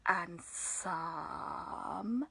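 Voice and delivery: drawn-out, low and soft; slow drawl. Shown as a demonstration. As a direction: stretch it slowly like a snake. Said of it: "What's the opposite of fast?".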